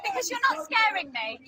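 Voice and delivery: slow, high, defiant taunt. Real, not acted. Man points at her.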